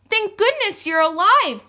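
high, loud exclamation of relief; relieved cry. A narrator's reading. Miss Lily at the rescue, relieved cry. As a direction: cry out thankfully. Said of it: "cried Miss Lily".

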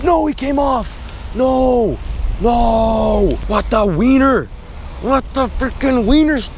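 real anguished shouts at a lost fish; frustrated yell. Real, not acted. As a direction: cry out in dismay, drawn out. Fish comes off the hook.

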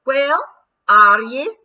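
loud, high Scots retort; cocky Scottish pixie. A narrator's reading. Rob Anybody popping out of the ground, loud cocky Scots. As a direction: bounce back cheeky and loud. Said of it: "he said".

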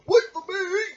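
very high, loud toy bear voice; squeaky bear pleading. Acted. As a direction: call after the boy. Bear left in park.

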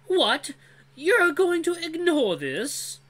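high, slow, indignant yell; outraged. Acted. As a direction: protest in disbelief. Dran outraged at leaving.

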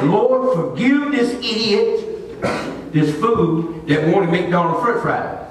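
slow, drawn-out acted prayer; mock contrite plea. Preacher asking the Lord's forgiveness over fries, slow contrite plea. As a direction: drag out the self-reproach to God.

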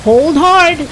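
loud barked halt, pitched high; captain's yell. A narrator's reading. Captain stops the roll call with a loud yell. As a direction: bellow it like a captain stopping the line. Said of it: "yelled Captain Cutthroat".

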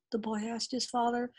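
ordinary delivery, nothing marked

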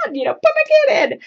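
high, fast, whiny mock plea from the bleachers; impatient sideline parent. Mom on the sideline pleads with the coach to play her kid, high fast whine. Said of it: "And I'm sitting there going".

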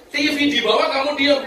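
high, loud outraged question; indignant husband. Acted. Husband scolds his wife over the stolen TV, outraged.

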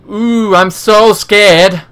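slow, high sarcastic "so scared"; hammed-up sarcastic taunt; mock fear. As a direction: drag it out to mock him. Mocking the enemy's threat.

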